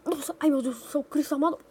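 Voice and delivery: usual speaking voice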